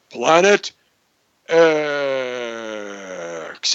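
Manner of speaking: long, descending deep X. Shown as a demonstration. Host leads everybody on three, in a deep voice. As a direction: lead the chant, stretching X out deep. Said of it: "You gotta say it with a deep voice".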